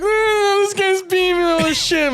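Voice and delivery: retold high-pitched wail; mock crying. Kid losing at a car game, mock wailing in a high voice. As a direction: imitate a tearful gamer whining. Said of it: "I started crying a bit".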